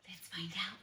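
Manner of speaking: soft hushed whisper; suspense whisper. Acted. Teacher building suspense for the kids, whispered.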